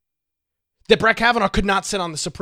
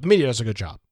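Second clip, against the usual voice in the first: lower, dry repeat of the mock praise; deadpan sarcasm; 6 semitones lower, much faster than usual. Host repeating the claim to listeners, lower deadpan sarcasm. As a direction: drop the pitch and let the irony sit.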